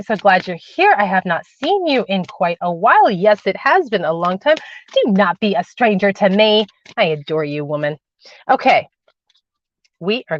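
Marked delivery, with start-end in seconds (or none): none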